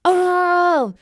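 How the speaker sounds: high, loud, held scream; retold scream. Storyteller screaming after the battle, long and loud. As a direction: let out one long high yell. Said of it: "I just screamed and said".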